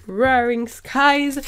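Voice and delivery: English words in a thick French accent, drawn out slowly; slow, halting French-accented English; bad English accent. Real, not acted. French YouTuber reading an English booster name, bad English accent. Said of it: "Désolée pour mon accent pourri en anglais".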